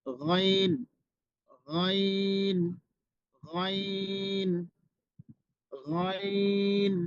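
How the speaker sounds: গইন held very long, a throaty gh sound; drawn-out ghain. Shown as a demonstration. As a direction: stretch out গইন slowly from the throat. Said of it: "এরপরে অক্ষরটি হচ্ছে গইন". (টেনে টেনে গইন)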